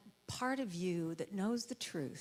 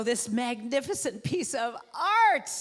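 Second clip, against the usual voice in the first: louder and slow, savouring every word; hammed-up proud purr; mock grand self-praise; 4 semitones higher, 8 dB louder, slower than usual. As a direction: admire yourself like a masterpiece. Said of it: "look at your body and go".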